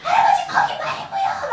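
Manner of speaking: put-on very high, loud kid yell; imitated children's impatient shriek; exasperated children. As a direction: shout out like frustrated kids correcting grandpa. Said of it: "애들이 막 너무 답답하고".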